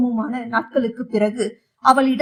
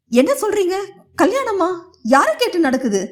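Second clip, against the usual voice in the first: shocked; 7 semitones higher than usual. A narrator's reading. Mother shocked by wedding news.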